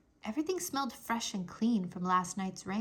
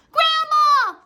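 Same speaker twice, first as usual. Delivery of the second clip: high, loud panicked call; cry for help; 13 dB louder than usual. A narrator's reading. Fudge calling to Grandma while his brother chases him. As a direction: scream for Grandma to save you. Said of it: "Fudge cried".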